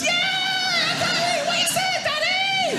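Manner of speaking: put-on very high shouted kid voice over the wind; shouting child. Child shouting to dad from the car window. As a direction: yell like a small child.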